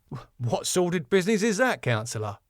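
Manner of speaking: all wide-eyed innocence; innocent. Acted. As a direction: play innocent, quickly and lightly. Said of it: "Tom, innocently".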